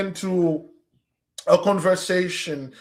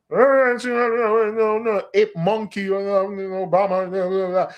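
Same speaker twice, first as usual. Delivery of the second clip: mock insulted cry; 3 semitones higher, slower than usual. Mimicking an insulted person.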